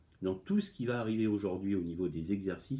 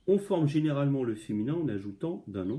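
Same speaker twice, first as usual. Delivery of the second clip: reading the rule aloud; 5 semitones higher, 4 dB louder than usual. Shown as a demonstration. Teacher reads rule from book.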